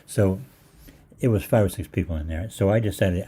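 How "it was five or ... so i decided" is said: ordinary talk, nothing marked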